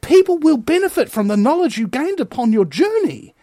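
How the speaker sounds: high, ringing rally-speech delivery; impassioned oratory. Real, not acted. Commentator promising listeners their journey matters, impassioned. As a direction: preach it high, phrase by phrase.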